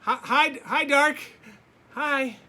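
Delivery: high, loud eager hi; boy calling brightly into the basement; excited little boy greeting. Acted. Little Laszlo calling cheerfully down to the dark in the basement.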